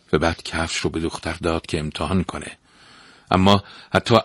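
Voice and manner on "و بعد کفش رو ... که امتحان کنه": plain reading voice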